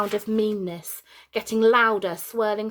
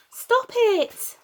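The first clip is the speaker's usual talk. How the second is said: much higher, loud pleading yell; children yelling; 5 dB louder than usual. Acted. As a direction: beg him to stop, at full voice. Soaked children pleading with father.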